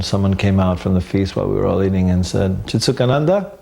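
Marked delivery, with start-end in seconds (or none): 2.7-3.5 higher-pitched questioning call, rising at the end; calling a name. Retold or imitated. Messenger seeking devotee at the feast, questioning call. Someone calls him out of feast.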